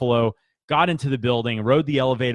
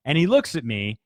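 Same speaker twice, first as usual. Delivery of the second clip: usual speaking voice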